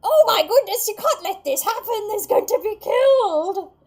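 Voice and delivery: put-on very high, very loud frantic old-lady shriek; shrieking elderly lady. Elderly lady standing to stop the act, shrieking. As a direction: screech it like a panicked granny. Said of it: "an elderly lady stands up".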